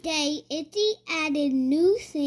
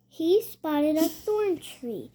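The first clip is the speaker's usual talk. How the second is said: ordinary delivery, nothing marked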